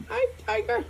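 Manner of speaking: very high, soft cooing. Real, not acted. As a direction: softly coo "Hi" up high. Owner cooing at his dog.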